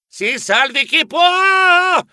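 frantic high cry to flee; shouting. Acted. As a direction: yell it while running to hide.

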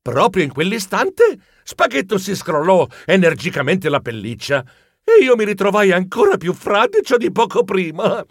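usual speaking voice

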